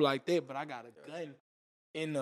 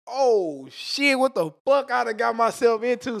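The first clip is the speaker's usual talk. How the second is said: retold panic; 7 semitones higher, 8 dB louder than usual. Panicking seeing police arrive.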